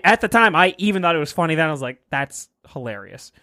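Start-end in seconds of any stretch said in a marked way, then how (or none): 2.1-3.3 quiet aside. Retold or imitated. Recalling private thought, quieter.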